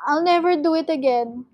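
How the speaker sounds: high, anxious promise; frightened, imploring vow; pleading cry. A narrator's reading. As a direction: swear it in desperation. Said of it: "cried Mister Gregg".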